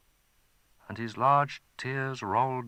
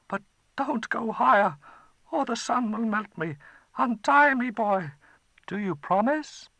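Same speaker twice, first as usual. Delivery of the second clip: high, louder frightened pleading; a scared dragon bargaining; 14 semitones higher, 6 dB louder than usual. Acted. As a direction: dragon begging the boy to stop.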